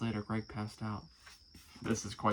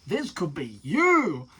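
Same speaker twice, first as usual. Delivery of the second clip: slow, louder salesy promise; scam pitch; 4 semitones higher, 8 dB louder, slower than usual. Retold or imitated. Mocking scam presenters' pitch, slow salesy voice. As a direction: oversell it like a cheesy pitchman. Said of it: "this whole presentation of, like".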